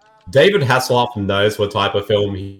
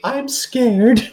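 mock scared; 11 semitones higher than usual. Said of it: "to quote you, Marcy".

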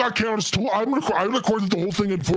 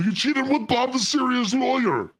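high mock accusation; 7 semitones higher, slower than usual. Accusing her of cheating.

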